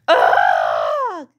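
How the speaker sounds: long, loud throaty roar; frustrated growl. Acted. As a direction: roar out a tantrum. Child's tantrum.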